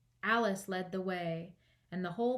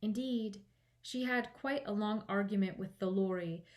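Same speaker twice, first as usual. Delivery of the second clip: plain reading voice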